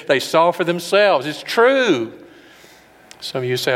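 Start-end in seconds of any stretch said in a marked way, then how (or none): 0.1-2.1 rising conviction. Real, not acted. Preacher rising to climax.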